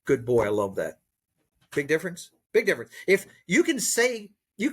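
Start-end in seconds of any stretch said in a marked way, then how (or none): 0.1-1.0 put-on lower, dull "Good boy. I love that." with no feeling behind it; just mouth the syllables, no emotion; perfunctory, emotionless praise; empty praise. Shown as a demonstration. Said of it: "This is not praise".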